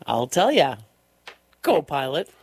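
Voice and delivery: normal talking voice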